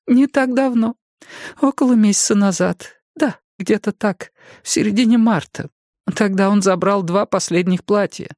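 высокий голос пожилой женщины; мягкая разговорчивая старушка. A narrator's reading. Jenny answers. (голос старушки)

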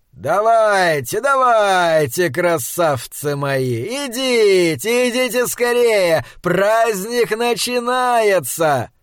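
high, drawn-out taunting shout; loud carrying call. A narrator's reading. Kearns luring the monsters across the graveyard, loud slow call. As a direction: stretch each word out so it carries far. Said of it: "голос Кернса громко разнёсся над кладбищем".

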